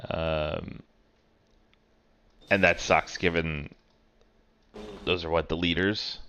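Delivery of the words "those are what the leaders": ordinary talk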